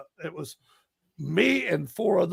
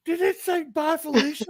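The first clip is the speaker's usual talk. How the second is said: high-pitched imitation of a stunned girl; high-voiced mock disbelief; retold wide-eyed shock; 9 semitones higher than usual. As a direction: mimic a youngster's astonished question, pitched up. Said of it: "Her eyes got as big as saucers".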